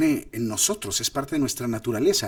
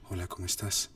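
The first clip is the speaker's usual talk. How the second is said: hushed, much softer and lower; whispering; 5 semitones lower, 8 dB quieter than usual. Shown as a demonstration. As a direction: say it as a soft whisper. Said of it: "lo podría hacer susurrándolo".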